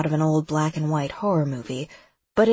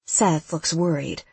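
ordinary delivery

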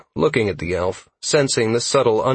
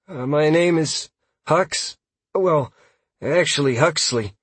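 higher-pitched, hesitant elf voice; timid, higher voice with halting starts; flinching reply; 7 semitones higher than usual. A narrator's reading. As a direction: answer as if flinching from a scolding. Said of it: "quickly replied, flinching under Sierra's tone".

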